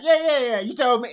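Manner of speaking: loud, high mock brush-off; impatient. Confessing how they answer repeated stories, impatient mimicry. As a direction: cut in with exaggerated impatience.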